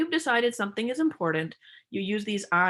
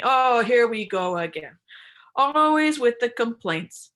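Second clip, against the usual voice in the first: louder, slow, fed-up sigh of a reply; exasperated dismissal; 3 semitones higher, 6 dB louder, slower than usual. Shown as a demonstration. Demo of a withdrawing partner, loud, exasperated. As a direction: roll your eyes at the complaint. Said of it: "contrasted with something like you go".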